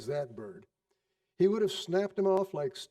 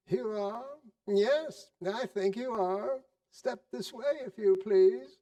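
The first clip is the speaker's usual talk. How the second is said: put-on higher, sarcastic old boss toying with clerk; lightly voiced, teasing mock anger; mock-stern old Scrooge; 6 semitones higher, slower than usual. A narrator's reading.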